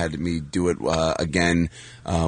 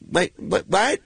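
high-pitched puzzled questions; imitated baffled mom; 11 semitones higher, slower than usual. Mom reacting to her son's news, baffled. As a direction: play a confused mom, pitch jumping up. Mom's reaction on phone voiced.

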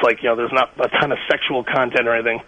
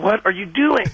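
baffled wife; 7 semitones higher than usual. Retold or imitated. Wife baffled by his drawl.

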